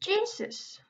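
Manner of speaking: high-pitched startled yelp; sharp startled Jesus; startled cry. Real, not acted. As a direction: jump at a sudden shock. Sudden startle in game.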